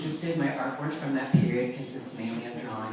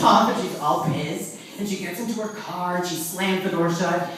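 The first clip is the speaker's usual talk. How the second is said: ordinary delivery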